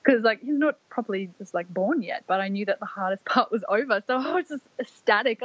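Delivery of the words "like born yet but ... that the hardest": ordinary talk, nothing marked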